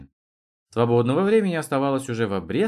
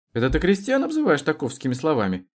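higher-pitched question with a smirk; smirking challenge; 7 semitones higher, 3 dB louder than usual. A narrator's reading. Trutnev needles the intellectual about peasants, with a smirk. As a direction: challenge him, mildly mocking. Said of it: "ухмыльнулся Трутнев".

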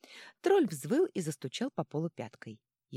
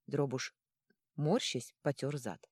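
plain reading voice

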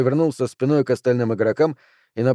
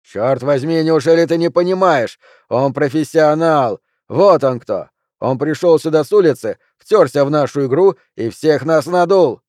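loud, indignant insistence; aggrieved raised voice; exasperated outburst; 3 semitones higher, 5 dB louder than usual. Acted. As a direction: argue hotly that they are all fools. Junior insists they were conned.

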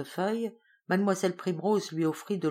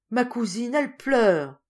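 a sad exclamation, slightly louder; alarmed and sorry for his weeping cousin; sad cry; 7 semitones higher, 7 dB louder, slower than usual. Acted. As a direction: Jacques calling out sadly about his cousin. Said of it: "s'écria Jacques tristement".

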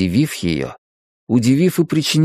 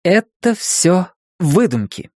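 higher, slow, each word set firmly; clipped firm voice; 7 semitones higher than usual. A narrator's reading. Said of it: "отчеканила Лизи".

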